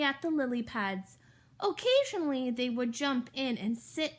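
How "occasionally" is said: usual speaking voice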